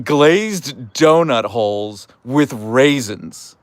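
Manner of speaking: high, slow, disbelieving cry of outrage; mock outrage. Real, not acted. Streamer reacting to chat's raisin donut, high drawn-out outrage. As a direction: drag out the shock like it's a scandal.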